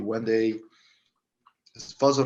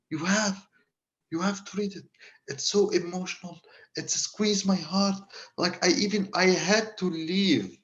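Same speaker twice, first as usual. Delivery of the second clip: much higher, pleading voice; high emotional plea; quoting the crying inmate; 8 semitones higher than usual. Retold or imitated. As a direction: plead like a man moved to tears. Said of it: "And he was like".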